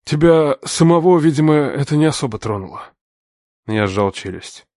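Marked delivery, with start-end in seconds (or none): 0.0-2.9 venomous sneer. A narrator's reading. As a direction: sneer it with poison. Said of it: "в этой усмешке было больше яда".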